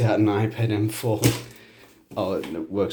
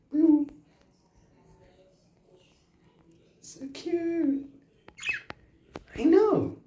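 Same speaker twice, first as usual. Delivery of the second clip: much higher, delighted squeal; gushing over pet; much slower than usual. Real, not acted. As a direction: gush over the cute bird.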